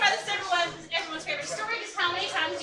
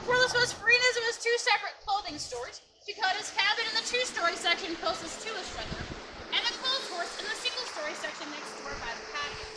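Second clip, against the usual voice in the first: tour guide narration; 3 semitones higher than usual. Acted. Ghost tour guide presenting.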